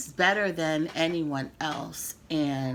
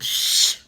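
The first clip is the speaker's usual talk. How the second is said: loud shush; 8 dB louder than usual. Real, not acted. Hushing herself mid-rant.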